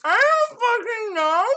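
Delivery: loud, muffled through a mouthful; shouting with mouth full. Real, not acted. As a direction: yell while chewing a burger. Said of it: "me shouting with my mouth full".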